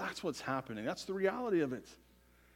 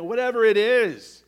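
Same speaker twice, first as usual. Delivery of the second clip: sudden shout; 6 semitones higher, 10 dB louder than usual. Real, not acted. Preacher building to a peak.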